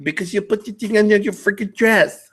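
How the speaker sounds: lightly voiced Sofia Vergara scolding; Sofia Vergara impression. Dad scolding selfie posters in a light Colombian accent. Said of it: "That's my Sofia Vergara".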